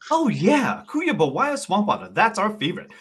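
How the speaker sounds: high, swooping bartender voice; sassy bartender. Acted. Bartender confirming a favorite drink, high and sassy. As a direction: sing the customer's order back approvingly.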